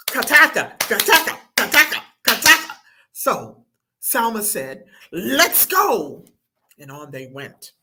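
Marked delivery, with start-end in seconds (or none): 0.1-2.8 loud, high rhythmic rattle of syllables; clapping-stick imitation. A narrator's reading. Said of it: "joined in with clapping sticks". 5.1-6.3 louder, higher call to set off; rallying call. A narrator's reading. Salma rallies the group.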